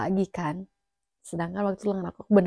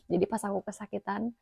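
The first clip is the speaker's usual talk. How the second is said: ordinary talk, nothing marked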